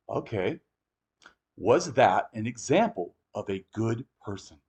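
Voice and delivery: soft, slow, pointed deadpan; deadpan question. Retold or imitated. To bigots, a slow, soft, pointed question. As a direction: ask it quietly, letting it sting. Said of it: "And I just go".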